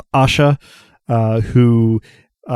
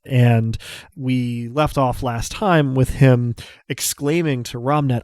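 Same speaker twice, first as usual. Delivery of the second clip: ordinary delivery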